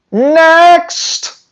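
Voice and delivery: next, tossed out high and scornful; scoffing dismissal. Real, not acted. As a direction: wave it off high and sharp. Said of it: "dismissing breeders".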